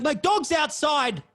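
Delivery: high, loud and slow call; dazed girl cry. Retold or imitated. The tripping girl calls out for her dog, high and slow. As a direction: call out slowly, high and lost.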